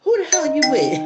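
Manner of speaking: high, loud mock yell out the window. Mom yelling at the boy from the window, loud mock shout. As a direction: shout at him like an angry mom.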